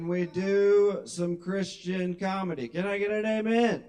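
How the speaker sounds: loud, rising preacher call; mock preacher. Comic calling out to the crowd like a preacher, loud. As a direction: rouse the congregation.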